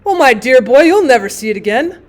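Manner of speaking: loud, laughing exclamation; booming through laughter; laughing loudly. Acted. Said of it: "burst into a roar of laughter".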